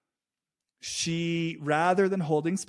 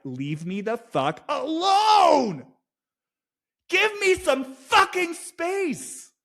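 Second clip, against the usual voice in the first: high, loud, crying shout of rage, slowed; furious shout; 13 semitones higher, 5 dB louder, much slower than usual. Retold or imitated. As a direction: scream at your mother to back off. Voicing Austin's anger at mother.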